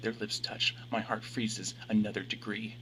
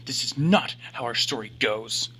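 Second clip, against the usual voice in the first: angry; 6 dB louder than usual. A narrator's reading. Yelling at his reflection.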